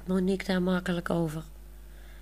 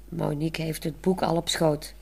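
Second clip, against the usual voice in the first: usual speaking voice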